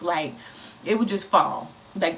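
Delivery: ordinary talk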